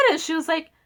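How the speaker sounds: ordinary delivery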